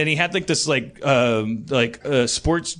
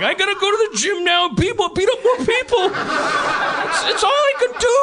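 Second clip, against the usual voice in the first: high, anguished mock lament; big strong guy. Big guy resigned to beating people up, high tortured wail. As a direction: wail helplessly, pitched way up. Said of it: "young, big, strong guy".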